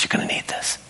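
soft unvoiced whisper in her ear. [name] whispering to Colleen at the family function. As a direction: slip it to her in a secret whisper. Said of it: "just whispered in her ear".

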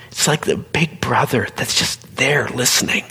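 usual speaking voice

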